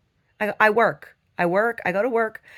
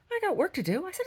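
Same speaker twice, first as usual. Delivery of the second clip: normal talking voice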